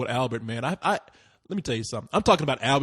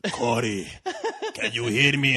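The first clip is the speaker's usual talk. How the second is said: put-on low foreign-accented check; Rudy; 4 semitones lower than usual. Janitor checks on drugged guest, foreign accent. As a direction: imitate the janitor's accent, pitched lower. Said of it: "He's like".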